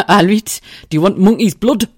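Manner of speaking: loud Geordie ice cream seller; Geordie van lady. Retold or imitated. Ice cream seller offering sauce to a customer, loud Geordie. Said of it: "And she went".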